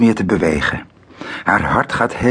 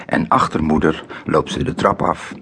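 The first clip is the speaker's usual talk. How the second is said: ordinary delivery, nothing marked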